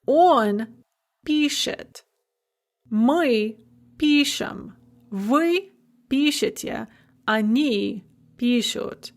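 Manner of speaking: each form of "писать" punched on "пи": "пишет, пишем, пишете, пишут"; stress on first syllable. Shown as a demonstration. Teacher drilling shifted stress, "пи" stressed in "пишет" through "пишут". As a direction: recite "пишет, пишем, пишете, пишут" deliberately, spaced out, hitting "пи". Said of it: "the first syllable in all the other forms".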